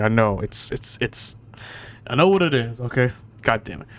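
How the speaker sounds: usual speaking voice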